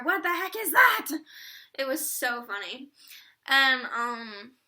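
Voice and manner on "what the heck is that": high, loud baffled outburst; mock surprise. Band stopping mid-song at the curtain, loud mock surprise. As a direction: break off the song in disbelief. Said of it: "then they'd be like".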